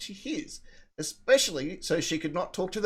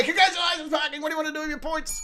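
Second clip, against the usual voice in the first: game show host; 9 semitones higher, faster than usual. Pretend. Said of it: "we can all do the math and say".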